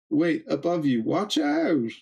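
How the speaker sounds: high-pitched acted warning cry; urgent warning. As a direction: call out a warning, pitch climbing at the end. Reads out a character's warning.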